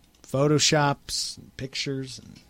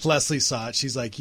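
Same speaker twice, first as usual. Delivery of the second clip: ordinary talk, nothing marked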